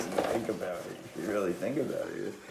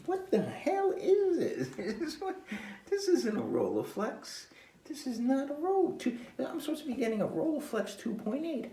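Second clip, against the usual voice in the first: exasperated rant; 3 dB louder than usual. Real, not acted. Continued indignant rant.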